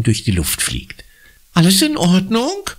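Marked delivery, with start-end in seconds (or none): 1.5-2.6 high, startled concern. A narrator's reading. Neighbour asks Conny after the near crash, startled. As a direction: ask in fright. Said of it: "fragt Frau Sandulescu erschrocken".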